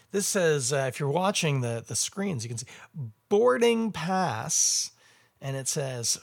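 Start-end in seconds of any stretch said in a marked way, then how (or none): 3.3-4.9 slow drawn-out. Acted.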